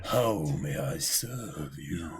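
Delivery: low, slow, seductive purr; sultry sorcerer voice. Retold or imitated. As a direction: tempt them slowly, deep and sultry. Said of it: "That sultry, sexy, dangerous voice".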